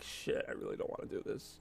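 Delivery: a hushed unvoiced gripe; whispered grumble. Dreading a six-hour shift at Staples, whispered grumble. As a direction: whisper the complaint under your breath. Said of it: "and I'm like".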